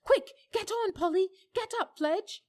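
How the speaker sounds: high, loud urgent shout to friends; a boy calling his friends in a panic, shouting. A narrator's reading. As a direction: yell for them to mount up and go. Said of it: "was shouting out".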